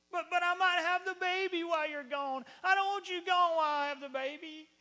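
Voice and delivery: high, loud whiny wail; mock crying. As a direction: wail like a hammed-up weepy wife. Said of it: "she really starts crying".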